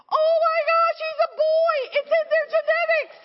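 high, loud amazed squeal of a mother; shocked excited squeal. Retold or imitated. Mother squealing at her baby's backflips, very high and loud. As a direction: shriek it, thrilled and stunned.

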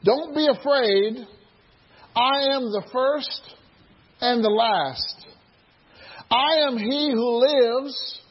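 slow, weighty reading of Christ's words; solemn voice of the risen Christ; slow solemn scripture voice. Acted. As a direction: let each phrase land slowly and gravely. Reading Jesus' words slowly.

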